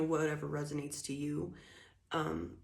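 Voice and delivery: ordinary delivery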